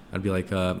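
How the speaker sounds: usual speaking voice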